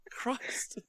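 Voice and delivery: soft, voiceless mock-appalled exclamation; whispered disbelief. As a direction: breathe it out under your breath, aghast. Reacting to imagined demand to speak faster.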